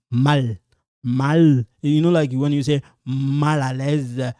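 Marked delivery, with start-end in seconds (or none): 0.1-1.6 "Mal" with the final l fully sounded; pronounced L. Shown as a demonstration. Teacher drilling the L for learners, clearly sounded l. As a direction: hold the l at the end of "mal". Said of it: "this L, you pronounce it".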